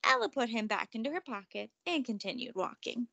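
ordinary delivery, nothing marked

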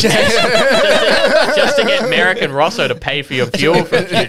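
high laughing voice; laughing hard while talking. Real, not acted. Laughing hard mid-story.

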